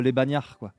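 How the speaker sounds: ordinary talk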